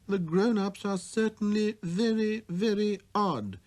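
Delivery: wondering aloud at adults; little prince. Acted. Prince muses.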